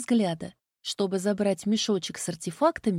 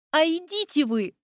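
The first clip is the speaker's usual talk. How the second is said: higher, exasperated retort; indignant outburst; 6 semitones higher than usual. A narrator's reading. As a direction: tell him off in a huff. Heroine tells him off.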